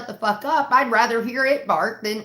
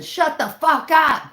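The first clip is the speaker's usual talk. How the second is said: higher, louder, slow mock yell; mock screaming; 7 semitones higher, 6 dB louder, slower than usual. Family screaming at the barking dog, mock yell. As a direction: belt it out at the dog. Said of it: "it turns into".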